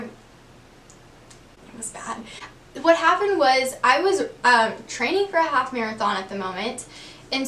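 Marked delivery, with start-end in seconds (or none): 0.0-2.2 slow, breathy whisper. Real, not acted. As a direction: drop to a whisper in embarrassment. Embarrassed aside after the fall.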